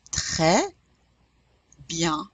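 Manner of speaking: slow French "très bien", nasal byan without an N; silent S and N. Shown as a demonstration. Teacher models for learners, slow French "très bien". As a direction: separate "très bien", dropping final S and N. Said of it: "We're not pronouncing the N either".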